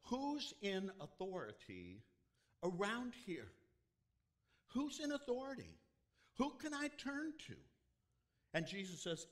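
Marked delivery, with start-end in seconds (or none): 0.0-3.5 high, loud plea for someone in charge; a lost man calling out loud; desperate cry. Retold or imitated. Man crying out for help. 4.7-7.6 much higher, loud plea with stress on in; a lost man pleading louder; even more desperate cry. Retold or imitated. Man crying out again.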